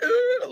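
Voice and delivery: shrill dismissive ugh; mock squealed ugh; high disgusted grunt. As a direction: scoff high and sharp. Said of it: "And like".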